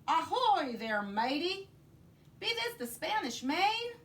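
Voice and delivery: saudação de pirata, aguda e gritada; pirata gritando. Acted. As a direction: grite de longe como um capitão pirata. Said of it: "the head pirate climbed out of the boat and yelled".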